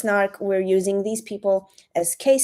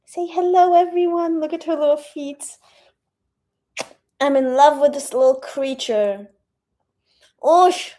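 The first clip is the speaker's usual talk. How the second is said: overwhelmed by cuteness; 6 semitones higher, 5 dB louder, faster than usual. Real, not acted. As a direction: coo at her like she's the cutest thing. Cooing at the dog.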